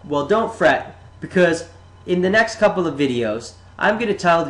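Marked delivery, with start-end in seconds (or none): none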